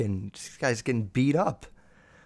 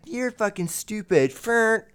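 high, teasing jab at her husband; nagging wife; 7 semitones higher, slower than usual. Retold or imitated. Wife mocking her husband before a nipple twist, high teasing. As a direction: sneer it like a bratty wife.

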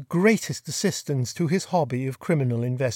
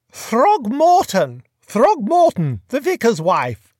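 put-on chatty, high-pitched grand old lady; imperious plummy grande dame; 7 semitones higher, 6 dB louder, slower than usual. A narrator's reading. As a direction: gossip like a fluttery duchess. Duchess spells name slowly.

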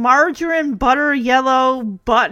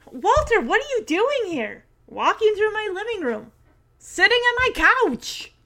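high-pitched, incredulous questioning; acted exasperation; 8 semitones higher than usual. Stephanie confronts Walter in her living room, exasperated. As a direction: imitate a girl affronted by an unwanted visitor.

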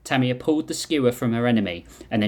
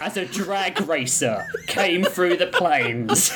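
laughing narration; 6 semitones higher, slower than usual. Real, not acted. Mimics background noise, laughing.

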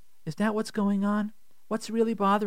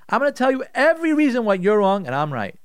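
louder, accusing prosecutor voice; mock prosecutor attack; 4 semitones higher, 6 dB louder than usual. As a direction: hammer each point like an attacker. Inner prosecutor attacking the wife.

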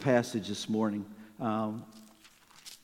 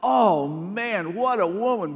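starts easy, then climbs higher and louder into giddy delight; a smitten man getting more and more carried away; growing excitement; 6 semitones higher, 5 dB louder than usual. Pretend. Man smitten at first sight of his future wife, building giddy excitement. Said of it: "I'm guessing you were thinking".

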